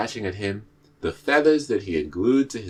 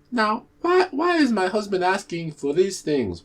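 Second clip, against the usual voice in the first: high, bewildered questioning; puzzled wife; 12 semitones higher than usual. Acted. Ijapa's wife puzzled by the guards' message, high voiced. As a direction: wonder aloud what her husband wants.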